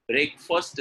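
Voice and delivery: BREAKFAST with a reduced "fust" ending; correct pronunciation. Shown as a demonstration. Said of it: "is correct".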